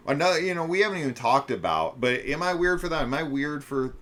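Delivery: high, loud and eager; blurting out an eager question; excited. Real, not acted.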